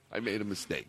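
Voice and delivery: quiet mock confession; deflated mutter. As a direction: drop it to a hush. Repeated catchphrase, much softer.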